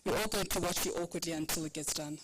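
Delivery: ordinary delivery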